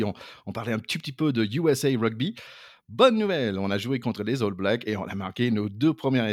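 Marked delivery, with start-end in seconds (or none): 2.9-3.6 BONNE lancé haut et fort, puis redescendre; annonce enjouée. Shown as a demonstration. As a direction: proclamer la bonne nouvelle avec entrain. Annonce contrastée de bonne nouvelle. (annonce triomphale, voix haute et forte)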